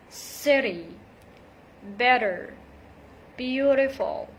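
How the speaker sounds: city, better, beautiful with quick D flaps. Shown as a demonstration. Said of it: "like in words".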